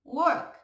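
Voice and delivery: "Work" said clearly with a rising end; English "work". Shown as a demonstration. Teacher introduces the word Work to the class. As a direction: announce the new word crisply. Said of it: "vou passar pro próximo".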